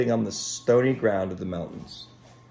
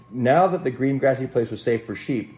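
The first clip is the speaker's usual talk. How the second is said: ordinary delivery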